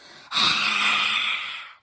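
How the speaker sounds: long loud breathy roar of air, tongue out; lion's breath exhale. Shown as a demonstration. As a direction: breathe out fire like a lion. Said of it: "imagine that you're breathing that angry fire and go".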